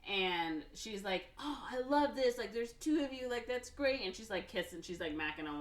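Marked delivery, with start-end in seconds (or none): 1.4-4.0 high, loud gush of mock delight; retold delight. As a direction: imitate her being thrilled. Delighted woman finds two of him.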